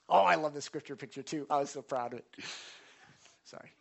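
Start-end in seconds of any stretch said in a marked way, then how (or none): none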